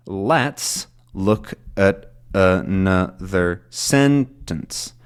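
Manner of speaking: very slow, each syllable apart, "sentence" strongest; slow stressed breakdown. Shown as a demonstration. Teacher showing learners the flow of stress, slowly broken down. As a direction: spell out the beats, strong "Let's" and "sentence". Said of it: "you get this sort of flow of stress".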